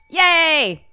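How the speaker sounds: high, loud drawn-out yay; playful cheer. Pretend. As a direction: let out a big gleeful cheer. Cheering between story turns.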